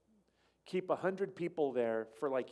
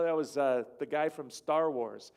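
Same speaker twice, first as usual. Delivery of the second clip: ordinary talk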